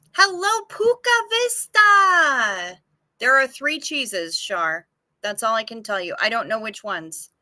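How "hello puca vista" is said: very high, slow crooning hello to a viewer; drawn-out high sing-song welcome; stretched-out greeting. Real, not acted. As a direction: stretch the name out like a delighted call.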